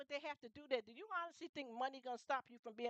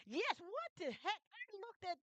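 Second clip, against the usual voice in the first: much higher, slow, stretched-out disbelief; shocked disbelief; 10 semitones higher than usual. Real, not acted. Reacting in shock to what the pastor said, high and slow. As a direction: let the outrage lift it high.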